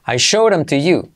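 ordinary delivery

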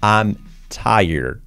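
"I'm tired" with "tired" as "TAI-erd"; falling model of "I'm tired", English r; pronunciación modelo. Shown as a demonstration. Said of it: "¿cómo se pronuncia?". ("I'm tired" con "tired" como "táierd")

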